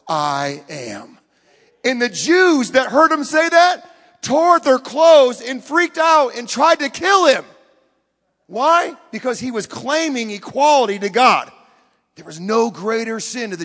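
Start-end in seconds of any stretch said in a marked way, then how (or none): 0.1-1.2 low, weighty quoted claim; solemn declaration. Retold or imitated. Jesus answering his challengers, low and solemn. 1.8-11.5 loud, slow, high preaching; forceful preaching. Real, not acted. Preacher telling of the outraged crowd, forceful and slow.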